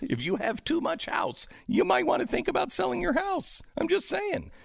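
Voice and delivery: soft, squeaky falsetto suggestion; high-pitched hinting. Acted. Host slipping a house-selling hint into the show, high-pitched hinting. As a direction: float it up high, lightly and quietly.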